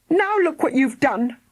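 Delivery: put-on girl hollering at arguing parents. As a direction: yell up at her parents. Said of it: "hollered Philly".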